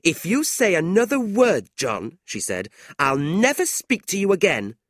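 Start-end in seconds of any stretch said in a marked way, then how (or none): 0.0-2.2 high, loud indignant warning; angry. A narrator's reading. Tilda warning John after his rudeness, angry. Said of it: "Tilda was angry too". 3.0-4.8 high, louder indignant threat; angry. A narrator's reading. Tilda threatening John with silence, angry. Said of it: "Tilda was angry too".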